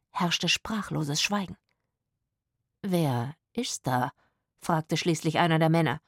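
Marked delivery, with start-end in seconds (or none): 2.8-4.1 slow, lower, hesitant question; astonished. A narrator's reading. Hugo asking into sudden silence, astonished. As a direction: ask slowly, taken aback. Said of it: "klang erstaunt".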